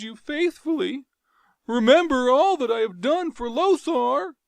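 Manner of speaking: high, loud desperate screams for pity; terrified begging. Acted. Jav begging Tario for mercy, terrified. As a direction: scream and beg for life. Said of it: "screaming in terror".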